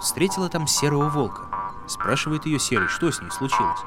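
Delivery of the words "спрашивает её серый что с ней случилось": ordinary delivery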